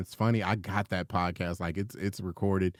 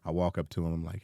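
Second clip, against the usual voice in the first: ordinary talk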